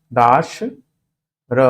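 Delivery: दाश, a pause, then र alone; syllable by syllable. Shown as a demonstration. Teacher building the derived word, दाश and र apart. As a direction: say दाश with long aa, then र separately. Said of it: "दा हो जाएगा ये".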